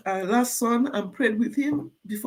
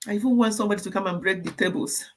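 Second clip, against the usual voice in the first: ordinary talk, nothing marked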